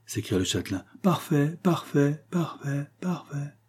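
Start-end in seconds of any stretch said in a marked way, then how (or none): 1.0-3.5 fading echo. A narrator's reading. Hidden child imitates an echo, fading away. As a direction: echo it back, dying away. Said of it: "dit l'écho".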